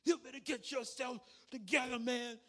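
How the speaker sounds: high, loud mock yell at the kids; imitating a coach screaming at nine-year-olds. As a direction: bark it like an angry youth coach. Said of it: "he was screaming at the kids".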